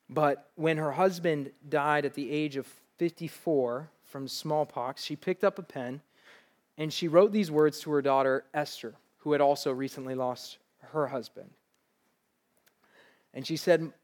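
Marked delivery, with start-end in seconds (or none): none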